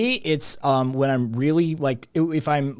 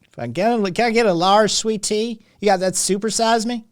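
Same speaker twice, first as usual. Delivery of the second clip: high, louder mock Southern ordering voice; pitched-up drawling fast-food customer; 10 semitones higher, 4 dB louder than usual. Plays Southern customer ordering sweet tea.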